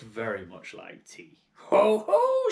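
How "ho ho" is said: very high, loud gloating hoot; triumphant hag cry. Acted. Grandma catching George with the cup, high gloating hoot. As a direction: crow it out in triumph. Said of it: "she cried".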